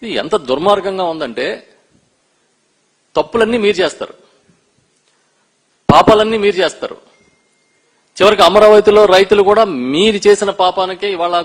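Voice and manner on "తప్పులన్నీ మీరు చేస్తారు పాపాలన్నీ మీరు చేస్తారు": hits తప్పులన్నీ and పాపాలన్నీ hard and loud, each line falling; accusing emphasis. Real, not acted. Politician to the government, pounding each accusation. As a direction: accusation hammered out one line at a time.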